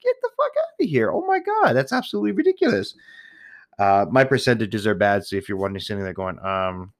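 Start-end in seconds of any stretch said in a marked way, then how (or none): 0.0-2.9 high-pitched incredulous rant; indignant, voice shooting way up; outraged. Real, not acted. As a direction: squeal in disbelief at the cost. Outraged rant over tip.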